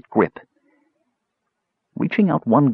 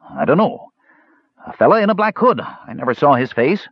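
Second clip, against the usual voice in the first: higher, hurried pleading; eager frightened thief; 6 semitones higher than usual. A narrator's reading. Captured thief pleads eagerly with the mage. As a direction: say anything to be spared.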